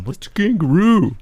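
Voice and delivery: high baffled character voice; confused old-timer. Retold or imitated. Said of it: "the other guy's going".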